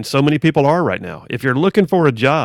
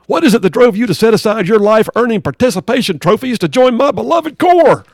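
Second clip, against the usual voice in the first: drill sergeant; 8 semitones higher, 5 dB louder than usual. Acted. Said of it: "drill sergeant".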